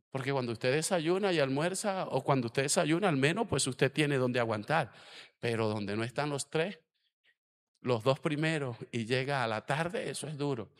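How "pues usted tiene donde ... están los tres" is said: ordinary talk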